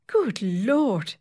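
prim gasp of shock; scandalised exclamation. A narrator's reading. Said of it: "exclaimed the secretary".

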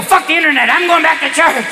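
high, eager mock outburst; excited kid. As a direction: blurt it out like a thrilled youngster. Kids' excited reply to samba nuns.

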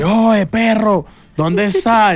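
calling out to a friend, playfully; imitating Diana on the phone. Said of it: "me llama Diana y me dice". (imitando a Diana, llamando por teléfono con voz alta y alargada)

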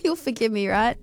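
ordinary delivery

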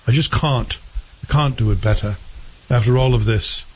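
put-on English accent with broad "cahn't"; hammed-up English accent; mock English accent. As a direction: do a bad English accent, dropping final r's. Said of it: "Terrible English accent".

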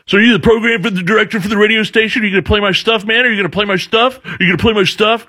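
high, pushy badgering rant; mock aggressive rocker pressing; in your face. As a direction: push into his face, demanding. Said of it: "almost getting in my face".

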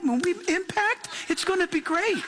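high, cheerful false calm; mock cheery confidence. Mocking upbeat flight crew, high cheery false calm. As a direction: sell the crash as good news. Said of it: "so confident".

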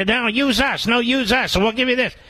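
high, pleading mock ad pitch; panicky advertisers. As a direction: beg like a desperate advertiser. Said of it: "it's almost as if they're panicky".

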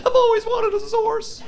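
put-on very high squealing teen; spoiled girl. Spoiled girl squealing over her gift, very high. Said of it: "She's like".

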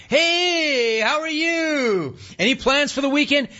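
very high, slow sing-song teller greeting; high mock teller small talk at the bank counter; chirpy bank teller. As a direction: overdo the bubbly teller. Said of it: "they kept doing me like that".

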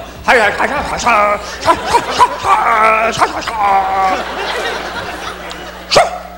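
mock child shout; kid yell. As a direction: yell like a wild toddler. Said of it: "just go".

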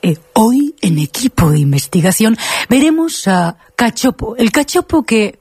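slow, drawn-out investigative-reporter voice; halting, over-modulated reporter delivery; Gloria Serra impression. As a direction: announce it with stretched TV suspense. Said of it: "Me gusta imitarlo".

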